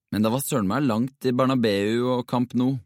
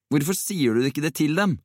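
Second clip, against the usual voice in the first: higher-pitched, insistent question; urgent pleading; 7 semitones higher than usual. A narrator's reading. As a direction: press a friend to speak up. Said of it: "prøvde Jakob inntrengende". (inntrengende og bedende)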